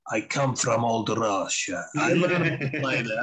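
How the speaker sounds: put-on slow, drawn-out Russian accent. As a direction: imitate an old Russian chess player, unhurried. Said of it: "in his lovely accent".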